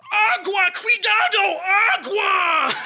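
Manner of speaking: high, loud warning shout; alarmed shout. Retold or imitated. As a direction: yell the warning at the whole house. Friend shouts Spanish warning to family.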